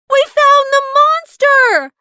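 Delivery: high, loud triumphant yell. A narrator's reading. Micah announces finding the monster, yelling high and loud. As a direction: shout the find in triumph. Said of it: "Micah yelled".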